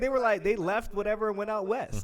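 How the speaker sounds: high, loud, excited acted reply; hammed-up enthusiasm, pitched high; eager answer. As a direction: play someone delighted to be guessed right. Acts out settler descendant's excited reply.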